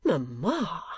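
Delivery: mildly shocked protest; remonstrance. A narrator's reading. Daughter protesting at her mother's boast, remonstrating. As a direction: protest in mild reproach. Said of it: "in a tone of remonstrance".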